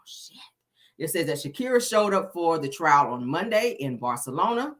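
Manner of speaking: ordinary talk, nothing marked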